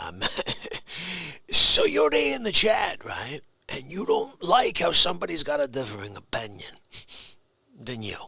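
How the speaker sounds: ordinary delivery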